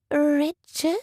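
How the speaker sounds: high, knowing rising question; sly girlish query. A narrator's reading. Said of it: "Georgiana had a sly tone".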